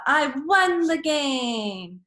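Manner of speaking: high, slow, thrilled shout of victory; excited shout. Shown as a demonstration. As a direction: shout it like you just won. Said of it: "want to say this with excitement".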